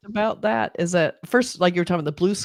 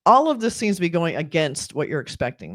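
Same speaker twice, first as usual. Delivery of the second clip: normal talking voice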